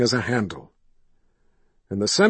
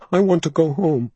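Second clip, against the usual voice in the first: put-on boy voice; 6 semitones higher, 5 dB louder than usual. A narrator's reading. Frightened boy pleads.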